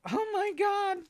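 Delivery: high, thrilled squeal of surprise; delighted gasp. Real, not acted. To the viewers, thrilled by the trading cards, high gasp. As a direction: gasp with sudden glee.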